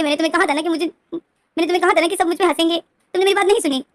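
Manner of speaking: high, loud tearful scolding; crying scold. Retold or imitated. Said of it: "रोते हुए बोलता है कि".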